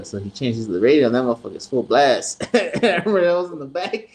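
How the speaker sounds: ordinary talk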